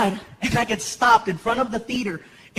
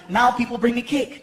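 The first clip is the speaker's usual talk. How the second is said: ordinary talk, nothing marked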